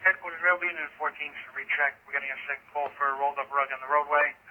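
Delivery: ambulance radio. Real, not acted. Unit calling dispatch over radio.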